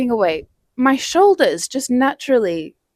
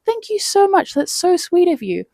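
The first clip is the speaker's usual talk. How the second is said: much higher, sweet thank-you; grateful thanks; 9 semitones higher than usual. Retold or imitated. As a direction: gush your thanks sweetly. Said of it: "I was like".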